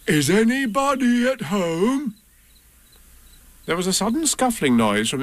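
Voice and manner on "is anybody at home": high, slow, sing-out call. A narrator's reading. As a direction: call into a hole to see who's in. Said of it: "and called out".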